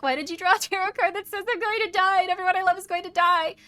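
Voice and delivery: high, loud panicked outburst; a startled client blurting in alarm; mock upset querent. Imagined upset client quote.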